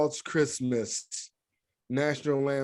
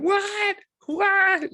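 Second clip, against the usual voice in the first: shrill mom; 4 dB louder than usual. Retold or imitated. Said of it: "and she went".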